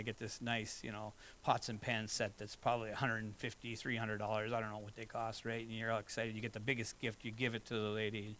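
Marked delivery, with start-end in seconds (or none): none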